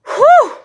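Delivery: high, loud "whew"; sharp venting whoop; exasperated whoop. Real, not acted. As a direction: blow off anger in one high hoot. Venting anger between rants.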